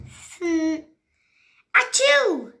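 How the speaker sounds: high loud sneeze. Acted. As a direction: burst into a sneeze.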